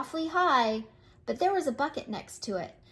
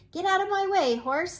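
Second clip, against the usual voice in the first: bossy rooster; 6 semitones higher, 5 dB louder than usual. Acted. Bossy cock orders horse.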